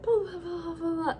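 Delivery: high-pitched sustained blah-blahs; mock pleading. Hero talking her round once he finds her, mock pleading. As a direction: imitate someone pleading in filler syllables, high and drawn along.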